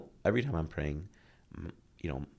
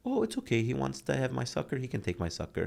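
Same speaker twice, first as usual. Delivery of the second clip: high start settling into normal pitch; sweetly giving in, child-like; easygoing little kid; 7 semitones higher than usual. Retold or imitated. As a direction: lightly imitate an agreeable child. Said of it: "that's what he used to do".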